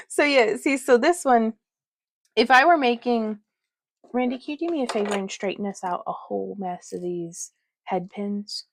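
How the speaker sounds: ordinary talk, nothing marked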